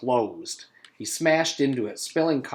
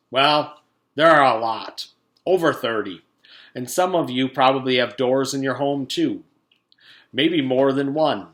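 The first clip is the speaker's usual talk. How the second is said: pompous principal; 3 semitones higher, 9 dB louder than usual. Acted. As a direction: lecture the school over the PA. Principal rambling on PA.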